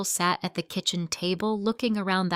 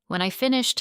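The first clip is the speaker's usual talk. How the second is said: plain reading voice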